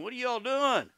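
high-pitched retold protest; mock outraged challenge. Preacher voicing Jesus overturning tables, mock indignation.

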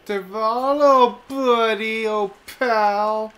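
loud mocking call-out; taunting shout. Real, not acted. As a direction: yell a sarcastic taunt. Taunting hidden enemy aloud.

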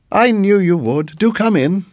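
a hint of a doting hostess; sweet landlady. A narrator's reading. Landlady voiced by narrator.

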